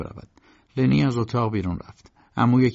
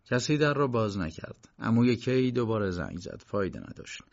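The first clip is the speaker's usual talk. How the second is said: plain reading voice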